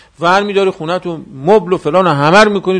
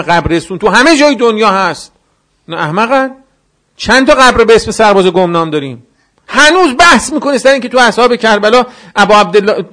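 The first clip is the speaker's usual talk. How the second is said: loud, higher impassioned sermon delivery; fervent preaching; 7 semitones higher, 7 dB louder than usual. Acted. Preacher rousing the mourners at the gathering, fervent and loud. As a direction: preach it out to the whole hall.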